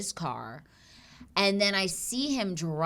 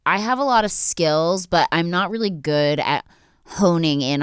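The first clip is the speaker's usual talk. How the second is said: louder, steady sponsor read; 4 semitones lower, 7 dB louder than usual. Acted. Host reads the sponsor ad to viewers.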